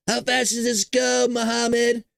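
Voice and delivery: high-pitched, excited passenger voice; hammed-up joyrider egging on the driver; Donald Trump. Mock Trump to Saudi prince in golf cart, excited impression.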